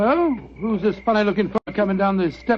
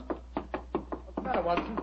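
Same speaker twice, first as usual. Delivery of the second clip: hushed teasing; 12 dB quieter, slower than usual. Acted.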